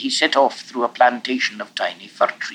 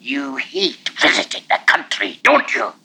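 hissed. A narrator's reading. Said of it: "thrust his face towards Alexander's and hissed".

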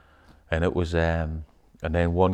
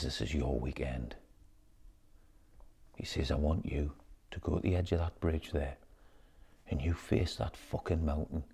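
solemn leader; 4 semitones lower, 12 dB quieter than usual. Retold or imitated. Retold leader's solemn speech.

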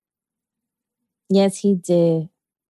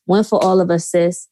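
normal talking voice

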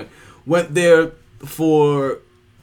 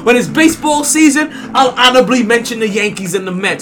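loud, pumped-up show intro; hyped podcast host; 6 semitones higher, 7 dB louder than usual. Acted. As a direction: hype up the audience.

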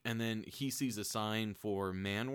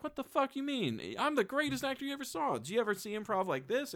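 much higher, indignant rant; mock furious actor; 13 semitones higher than usual. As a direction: rant like a wounded ego. Actor reveals himself in fury.

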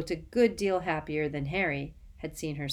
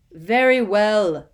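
slow, much louder, grudging; cold fury; 4 semitones higher, 13 dB louder, slower than usual. Acted. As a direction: spit it out in cold anger. Snape gives in furiously.

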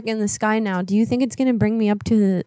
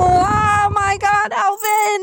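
high, wailing mock panic; scared; 9 semitones higher, 3 dB louder than usual. Caller pleads with Alvin during abduction, hammed-up panic. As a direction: beg to be saved in a hammed-up frenzy. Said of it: "I'm scared".

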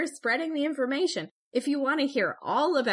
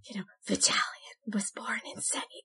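breathy ASMR whisper; 5 dB quieter than usual. Shown as a demonstration. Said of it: "it sounds terrible".

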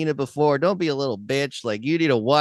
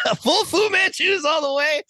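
high, jubilant announcement; excited cheer; 13 semitones higher than usual. Real, not acted. Host celebrating co-host's top rating, excited cheer. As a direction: crow it with delight, pitched way up.

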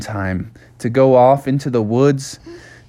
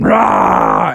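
higher-pitched open-mouthed roar; charging bear roar; 4 semitones higher, 4 dB louder than usual. Acted. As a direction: roar as you give chase. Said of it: "bear came running after him".

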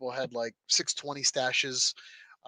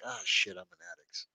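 much lower and softer, sinking aside; resigned realization; 4 semitones lower, 8 dB quieter than usual. Retold or imitated. Parts collector admitting he is hooked, in quiet mock resignation. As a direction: drop it low and quiet as a mock confession. Retold dawning realization of addiction.

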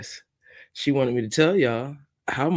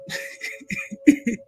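a high airy burst of laughter; high unvoiced giggle trailing down; high breathy laugh; 13 semitones higher than usual. Real, not acted. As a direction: laugh it off, tickled by the joke. Laughing at her own joke.

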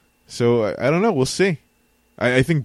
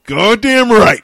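loud, high exclamation of approval; big booming cheer of agreement; hyped shout; 11 semitones higher, 10 dB louder, slower than usual. Real, not acted. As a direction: belt it out like a hype man. Co-host cheers on the interview intro.

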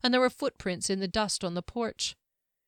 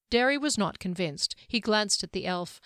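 usual speaking voice

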